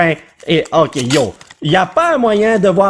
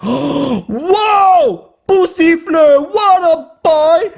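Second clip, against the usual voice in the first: excited gasp; 9 semitones higher, much slower than usual. Real, not acted. Gasps at new card.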